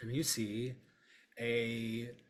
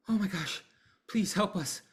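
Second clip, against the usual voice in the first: much higher, louder begging; desperate plea; 10 semitones higher, 10 dB louder, faster than usual. Acted. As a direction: beg for food, frantic and high. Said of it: "Sarah goes".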